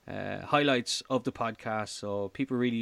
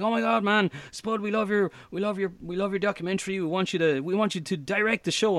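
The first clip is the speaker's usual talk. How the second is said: higher, hammed-up American producer voice; terrible American accent; 7 semitones higher than usual. Hollywood producers offering him the directing job, terrible American accent. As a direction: do a bad, gushing American accent. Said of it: "that's my terrible American accent".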